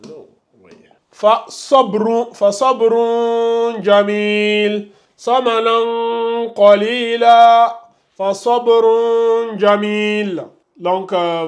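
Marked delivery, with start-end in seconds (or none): 1.1-4.9 "fa sabrun jamil" slowly, b closed and final n hummed; hidden tanwin. Shown as a demonstration. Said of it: "c'est sob". 5.2-7.8 "samanan qalila" slowly, final n held as a long nasal hum; hidden tanwin. Shown as a demonstration. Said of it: "ça donne". 8.2-10.5 "fa sabrun jamil" slowly, b closed and final n hummed; hidden tanwin. Shown as a demonstration. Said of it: "c'est sob".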